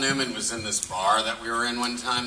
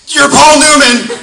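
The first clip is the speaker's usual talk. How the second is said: much higher, much louder star-struck shriek; starstruck blurt; 19 dB louder, slower than usual. Retold or imitated. Fan blurting at Paul Newman, shrieking starstruck and slow. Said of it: "I just went".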